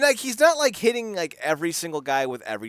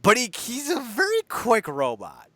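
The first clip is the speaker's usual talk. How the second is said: ordinary delivery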